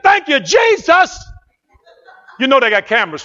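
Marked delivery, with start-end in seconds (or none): 0.0-1.2 high, loud, drawn-out praise shout; re-enacted shout of thanks; hollering praise. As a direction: holler it out in jubilation. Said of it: "I'm hollering".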